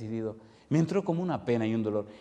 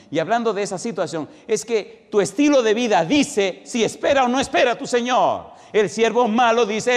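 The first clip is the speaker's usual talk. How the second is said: loud, driving sermon delivery, higher pitched; full-force preaching; 5 semitones higher, 6 dB louder than usual. Acted. Preacher urging his congregation to prepare, preaching at full force.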